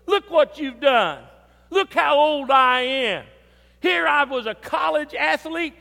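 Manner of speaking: hammed-up pity party; pouting self-pity. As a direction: whine it like a sulking child. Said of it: "I began to pout".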